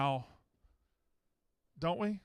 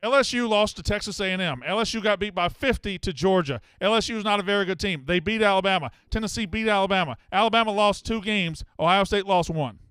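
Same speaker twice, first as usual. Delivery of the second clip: heated rebuttal; 3 semitones higher, 8 dB louder than usual. Real, not acted. Host rebuts caller heatedly.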